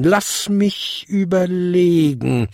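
slow, high, pondering; thoughtful. A narrator's reading. Old lady seeking a solution, thoughtful. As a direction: draw the words out as you think. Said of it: "fuhr sie nachdenklich fort".

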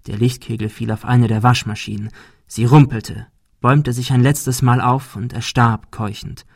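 ordinary delivery, nothing marked

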